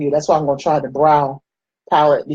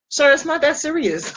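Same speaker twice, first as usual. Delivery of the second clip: mock polite reply; 10 semitones higher than usual. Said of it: "they're going in like".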